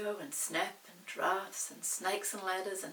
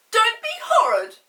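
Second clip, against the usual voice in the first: very high, loud indignant cry; indignant girl; 13 semitones higher, 20 dB louder than usual. Acted. Molly scolds rude Peter, high and loud. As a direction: protest shrilly like a hurt little girl. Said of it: "said Molly".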